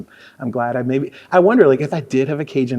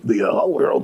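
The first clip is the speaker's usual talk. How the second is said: mock radio "puke" voice; radio presenter voice. Mocking other guys on the air, radio presenter voice. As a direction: put on a presentational announcer tone. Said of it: "they call it the puke".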